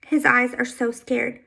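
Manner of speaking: usual speaking voice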